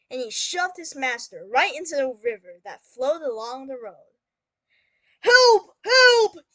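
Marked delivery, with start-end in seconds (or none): 5.2-6.4 urgent, high-pitched shouted cries; yelling for help. Acted. Cat hailing the passing king for his master, shouted cry for help. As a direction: yell for help at full volume. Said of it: "yelled Puss".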